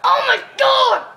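startled real outburst; surprised shout. Real, not acted. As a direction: yell it as the play breaks. Sudden big play in game.

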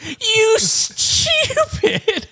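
very high, drawn-out squeal; mocking echo. Co-host mocking the impression back, high and very slow. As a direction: stretch it out in a silly high voice.

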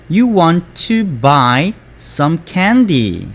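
usual speaking voice

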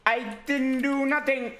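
high, drawn-out mock sobbing plea; hammed-up despairing whine; whining lament. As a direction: beg in a slow, exaggerated wail. Laments the ban and begs.

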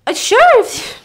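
put-on old lady voice. Neighbour answers, voiced higher.

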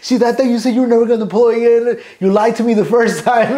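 high, loud mock mom scolding through laughter; upset mom. Mom scolds son for deploying again, high mock complaint. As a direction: play the betrayed mother, laughing as you do it. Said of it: "I was like".

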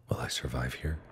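frightened whisper; fearful whisper. Real, not acted. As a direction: hush it like you're scared. Fearful inner thought.

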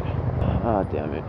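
normal talking voice

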